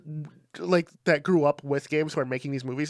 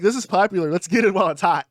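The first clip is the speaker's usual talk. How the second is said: louder, eager mock-executive voice; mocking studio execs; 4 semitones higher, 6 dB louder than usual. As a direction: play a greedy studio boss cashing in. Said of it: "they were like".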